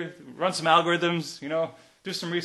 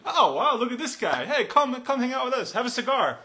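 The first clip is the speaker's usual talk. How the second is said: high, gushing mock admiration; high-pitched mock welcome into the club; mock admirer; 8 semitones higher than usual. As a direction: play someone sarcastically impressed by a snob. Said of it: "Any idiot who says the word aromatic".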